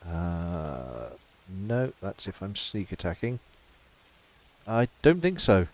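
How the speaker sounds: ordinary talk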